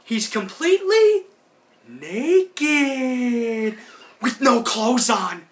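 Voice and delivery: high, excited cartoon voice, lightly hammed; imitating Heffer. Heffer gleefully confirms Rocco is naked, high cartoon voice. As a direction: stretch out naked with giddy glee. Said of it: "Heffer's like".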